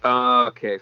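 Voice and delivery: usual speaking voice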